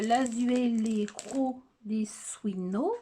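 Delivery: slow, laboured attempt at Spanish pronunciation; bad Spanish accent. Shown as a demonstration. Vlogger reading a collection's name, poor Spanish accent. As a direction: sound out the foreign title in clumsy Spanish. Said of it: "mais alors l'espagnol, je crois que c'est encore pire".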